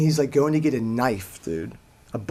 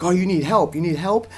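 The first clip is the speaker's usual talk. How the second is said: higher, eager mock offer of help; retold, slightly pitched-up fluster; imitated freak-out; 3 semitones higher than usual. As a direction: imitate a man frantic to help. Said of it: "freaking out".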